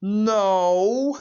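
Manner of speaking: a high, loud crying "No"; imitated anguished shout. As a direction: wail it out like a beaten villain. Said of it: "then Shooter McGavin says".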